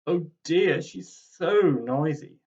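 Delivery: drawn-out put-upon groan; sighing Tim. Acted. Tim embarrassed by his noisy granny, exasperated and slow. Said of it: "sighed Tim".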